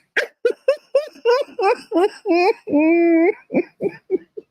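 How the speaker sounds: long high crying laugh; wheezing tearful laugh; helpless laugh. Real, not acted. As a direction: laugh until tears come. Breaks down laughing.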